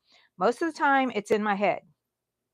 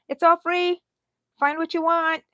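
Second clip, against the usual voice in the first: put-on higher and louder, cheerful announcement to the kids; excited giveaway call; 9 semitones higher, 7 dB louder than usual. Aunt calling nieces and nephews to grab free stuff, excited. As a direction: call it out like throwing open a treasure chest. Giving away stuff to family.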